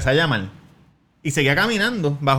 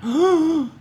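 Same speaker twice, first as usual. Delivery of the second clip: mock shocked gasp. Shocked by her question.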